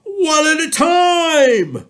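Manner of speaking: high, loud, slow shriek. A narrator's reading. Elephant warning the lion and tiger, high loud shriek. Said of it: "shrieked the elephant".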